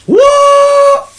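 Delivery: very high, loud shocked squeal; shrieked what. Retold or imitated. Girlfriend shrieking in shock. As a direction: shriek in disbelief.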